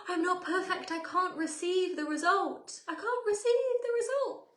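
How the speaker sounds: gasp, then high, drawn-out wail; mock panic. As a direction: wail it like a self-pitying catastrophe. Acts out shame over being imperfect.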